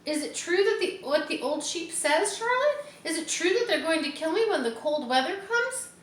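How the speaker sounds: terrified piglet; frantic fear. A narrator's reading. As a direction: panic while asking for truth. Wilbur panicking.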